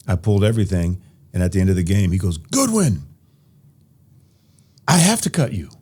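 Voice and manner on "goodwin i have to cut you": a high, imitated coach voice; imitating the coach telling him he's cut. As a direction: deliver the bad news like the coach.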